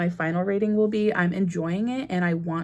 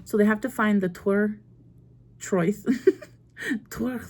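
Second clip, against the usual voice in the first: ordinary talk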